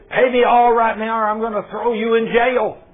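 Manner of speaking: loud, high menacing threat; angry threat. Acted. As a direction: threaten him hard. Creditor threatens jail.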